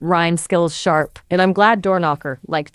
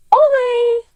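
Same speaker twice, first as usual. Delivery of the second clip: much higher, louder playful cry of Ole; mock cheer; 4 dB louder than usual. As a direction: toss out a festive Spanish cheer. Cheering after Spanish phrase.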